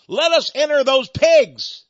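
loud, high, slow appeal; urgent plea. Retold or imitated. Demons asking Jesus for the pigs, acted urgent plea. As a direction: cry it out as imitated demons.

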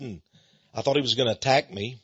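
ordinary talk

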